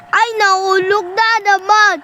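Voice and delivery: loud high exasperated yelp; screaming while falling. Real, not acted. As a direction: wail as you drop again. Falls yet again. (sigaw habang nahuhulog)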